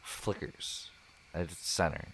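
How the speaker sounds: soft, calm scene-setting narration; story narration. Acted. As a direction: paint the campfire scene gently.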